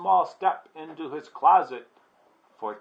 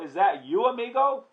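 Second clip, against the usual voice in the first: high, loud, rising question; excited greeting of a friend; exclaiming; 9 semitones higher, 5 dB louder than usual. A narrator's reading. Traveller recognises his amigo, exclaiming high. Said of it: "exclaimed Scapito".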